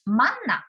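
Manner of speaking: "manna" with Busan pitch accent, high then falling; Busan intonation. Shown as a demonstration. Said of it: "first syllable in word is often given a higher".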